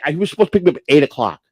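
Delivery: ordinary talk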